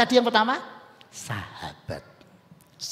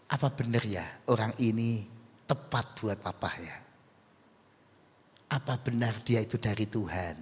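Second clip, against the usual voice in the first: calm gentle parent; 9 semitones lower, 6 dB quieter than usual. Acted. Parent dialogs gently with child.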